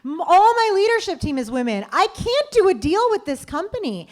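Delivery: mildly heated retold objection; indignant. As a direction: lay down the line. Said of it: "I'm like".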